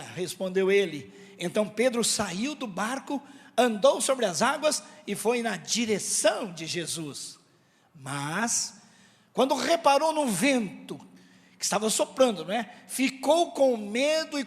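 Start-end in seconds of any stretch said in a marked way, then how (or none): none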